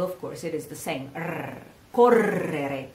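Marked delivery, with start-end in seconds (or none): 1.1-2.9 "R, correre" with the R drawn out in a slow trill; long double R. Shown as a demonstration. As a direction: hold the rolled double R in "correre". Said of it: "if you have a double".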